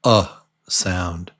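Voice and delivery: a short relaxed uh; schwa sound. Shown as a demonstration. Teacher demonstrating the schwa to learners, relaxed uh. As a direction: say "uh" as a loose, neutral grunt. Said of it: "it's called the".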